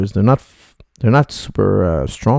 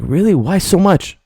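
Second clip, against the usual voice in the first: high surprised question, a touch of disbelief; 7 semitones higher than usual. Retold or imitated. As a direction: ask it high with mock astonishment. Retelling student's divorce story.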